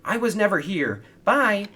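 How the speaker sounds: very high, loud fleeing squeak; hasty escape. A narrator's reading. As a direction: blurt it and dash off. Kevin fleeing Victor.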